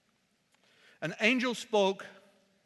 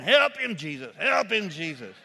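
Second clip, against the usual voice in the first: church ladies calling; 7 dB louder than usual. Retold or imitated. Preacher imitating church ladies in the pews, loud call. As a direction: call out to Jesus from the pew, swaying.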